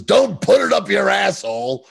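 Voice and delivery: high, loud comic warning; urgent mock shout. Stein runs in to stop Mick with the spear, mock shouting. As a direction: shout it like a frantic intervention. Acts out Stein running in to warn.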